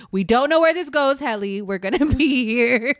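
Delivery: dad fretting they'll be stuck out there; mock panicky dad. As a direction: ham up dad's worry on the trail. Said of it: "that's literally how".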